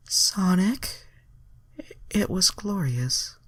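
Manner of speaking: put-on high, breathy-free girly alien voice; hammed-up high heroine voice; high Starfire voice. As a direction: play her dreamy and high. Reader voices Starfire's dialogue.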